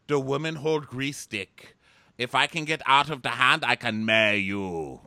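hammed-up Scandinavian, each word stretched; mock Scandinavian accent. Viking host explaining stick game, mock Scandinavian accent.

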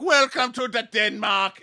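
put-on slow, high, loud drunken holler; drunk man. Drunk dude greeting tourists from the back, hammed-up drunk. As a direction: slur out a big boozy welcome. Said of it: "that drunk dude in the back's like".

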